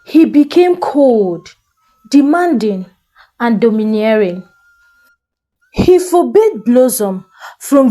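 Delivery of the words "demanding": usual speaking voice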